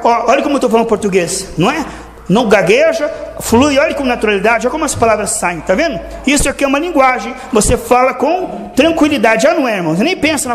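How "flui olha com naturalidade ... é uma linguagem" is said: ordinary talk, nothing marked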